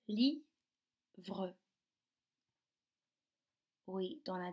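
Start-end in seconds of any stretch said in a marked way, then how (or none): 0.1-1.6 "Livre" as li, long pause, vre; split into syllables. Shown as a demonstration. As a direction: separate the two halves of "Livre". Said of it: "Est-ce qu'on entend le V".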